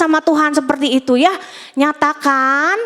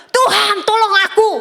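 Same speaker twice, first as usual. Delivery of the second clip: imitated cry for help; 7 semitones higher than usual. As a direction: mimic a drowning shout to God. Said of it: "Cuma teriak gitu loh".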